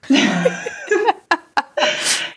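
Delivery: high cackle. Acted. Jawa cackle after pitch.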